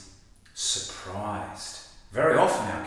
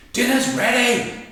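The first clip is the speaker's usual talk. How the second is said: much higher, louder call of dinner's ready; urgent mum call; 9 dB louder than usual. Shown as a demonstration. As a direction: yell it so they come running. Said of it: "But when mum says".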